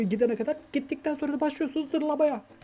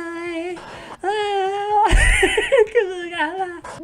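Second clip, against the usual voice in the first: laughing; 6 semitones higher, 6 dB louder than usual. Real, not acted. Laughing at the scene.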